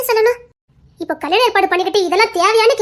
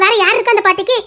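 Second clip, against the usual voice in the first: ordinary delivery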